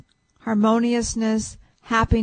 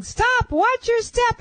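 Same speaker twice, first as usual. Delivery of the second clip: high warning call; imitating spirits yelling a warning; mock yell; 11 semitones higher, slower than usual. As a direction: call out a warning much higher. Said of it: "they're yelling".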